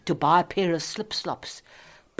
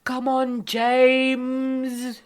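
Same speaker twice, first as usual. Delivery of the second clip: louder, higher call; 9 semitones higher, 4 dB louder, much slower than usual. Real, not acted. Woman calls out impatiently for James.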